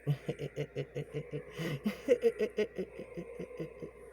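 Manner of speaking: high, wild witch cackle; crazy cackling laugh. Retold or imitated. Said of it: "That crazy fucking laugh".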